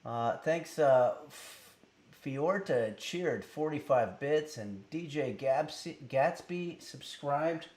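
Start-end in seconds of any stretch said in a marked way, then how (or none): none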